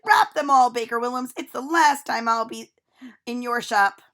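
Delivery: loud, angry demand. A narrator's reading. Lucy Woolley storms out of the bake shop angrily. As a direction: order it loudly.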